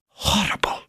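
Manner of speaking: a breathless whispered "Horrible!"; horrified exclamation. Acted. Queen recoiling from the Chancellor, horrified whisper. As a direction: gasp it out in shock. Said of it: "exclaimed the Queen".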